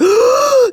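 loud, high mock gasp of panic; panicked gasp. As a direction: gasp sharply like a panicking child. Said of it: "just start to panic".

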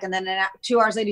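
ordinary delivery

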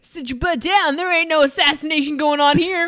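loud fast joking rebuke; mock scold. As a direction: snap at him playfully.

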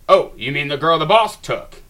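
smug sniper with a smile in his voice; smug, amused. Acted. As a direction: say it with a grin, taunting. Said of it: "with a smile in his voice".

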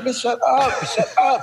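high laughing shut up; mock snap back. Comedian snaps back at heckling girl, laughing high. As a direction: swat her off with a laughing snap. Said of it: "I'm like".

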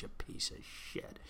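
voiceless whispered aside; whispered insult. Real, not acted. Whispered insult at Vindman under his breath. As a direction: hiss it under your breath.

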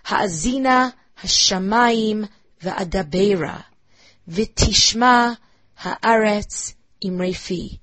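"Ha'azina Hashamayim Va'adabera" read slowly, each stressed syllable leaned on; stressed syllables shown. Shown as a demonstration. Teacher to class, showing equal meter: slow, beat-marked reading. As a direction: mark each stressed syllable of "V'tishma Ha'aretz Imrefi" clearly and slowly. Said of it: "think of the stressed syllables".

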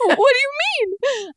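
high, far squeaky what; confused shock. Retold or imitated. Confused partner hearing an unexpected apology, squeaky and shocked. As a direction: shriek it up in disbelief.

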